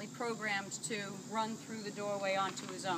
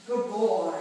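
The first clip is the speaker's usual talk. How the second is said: praising a dog; 9 dB louder than usual. Real, not acted.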